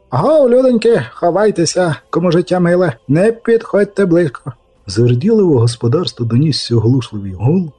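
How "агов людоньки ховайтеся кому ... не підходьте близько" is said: high-pitched warning to a crowd; raised, urgent hailing voice; calling out. Acted. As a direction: call people to take cover.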